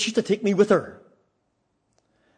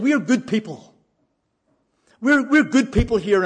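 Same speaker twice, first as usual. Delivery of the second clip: higher, insistent imitation of losing it; retold indignant protest; 6 semitones higher, 5 dB louder than usual. Partner insisting to the preacher they are good, imitated outburst. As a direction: protest loudly, as retold from an upset woman. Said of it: "she just lost it".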